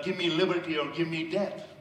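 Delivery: normal talking voice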